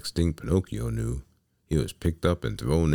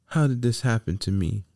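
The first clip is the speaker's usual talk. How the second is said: high-pitched, distressed puppet voice; dismayed lament; 9 semitones higher, 3 dB louder than usual. A narrator's reading. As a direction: wail it in self-pity. Trapped Pinocchio laments.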